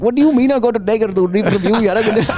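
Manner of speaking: high-pitched mock Indian accent; hammed-up indignant Indian driver accent; angry Indian accent. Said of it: "they get angry".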